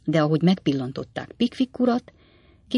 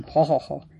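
a mocking, half-merry ha-ha-ha in another voice; loud laugh; 2 semitones lower than usual. A narrator's reading. Clerk Lauten finishing his joke with a half-mocking laugh. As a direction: laugh off your own cynical joke. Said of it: "hangos kacagással fejezte be".

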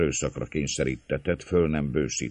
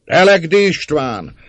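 slow, high shouted name; loud interruption; 14 semitones higher, 11 dB louder than usual. A narrator's reading. Another rioter cutting off the gatekeeper, shouting. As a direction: break in at full voice. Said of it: "szakítá félbe a szólót egy másik".

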